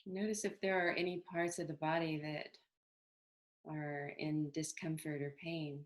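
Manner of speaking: calm, unhurried meditation guidance with long pauses; even, settled meditation voice; guided meditation. Acted. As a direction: gently lead them into body awareness, leaving space between phrases.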